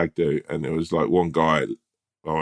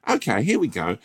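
nervous eager pitch; 8 semitones higher than usual. Pretend. Voicing himself pitching prank nervously.

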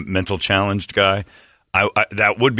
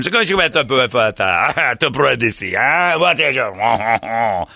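playful nonsense French, the last question dragged out very slowly; fake French accent. Comic fakes French on his phone, in put-on French gibberish. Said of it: "speaking tons of fake French".

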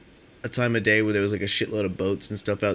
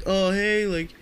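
high, sugary fake-friendly voice; mock the beer thieves with a phony sweet high hello; mock fake-nice greeting; 9 semitones higher, slower than usual. Beer thieves greeting them with a mock high fake-nice hello. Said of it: "being all fucking stupid shit, trying to be nice".

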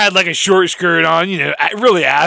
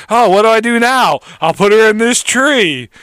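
higher and louder mock panic; panicked killer; 7 semitones higher, 6 dB louder than usual. The killer panics over the body, hammed up. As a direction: fret aloud like a clumsy killer. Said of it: "and it killed her".

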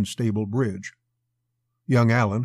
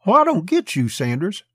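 high-pitched, louder puzzlement; bewildered; 8 semitones higher, 5 dB louder than usual. A narrator's reading. Young officer questions his older partner, bewildered. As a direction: question him, voice jumping up in bewilderment. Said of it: "Bewilderment in his eyes".

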